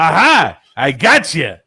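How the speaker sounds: loud, high gleeful pounce; triumphant gotcha. Real, not acted. Co-host catching his friend contradicting himself, loud triumph. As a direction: burst out in triumph.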